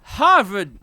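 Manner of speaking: slow, loud, high Boston drawl; Boston accent. Pretend. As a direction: stretch it out in thick Boston. Picks up the Boston bit.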